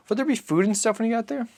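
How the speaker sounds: put-on high, light child's voice; a small boy asking his father; boy's voice. Narrator voices the boy asking Papa, high and childlike.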